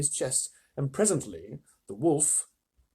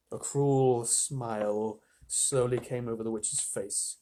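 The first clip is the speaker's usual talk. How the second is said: usual speaking voice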